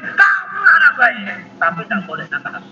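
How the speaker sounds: put-on high, loud, slowed praise to a child; positive shout. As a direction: yell it, but keep it kind. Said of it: "masih mengizinkan berteriak". (teriakan positif yang lambat kepada anak)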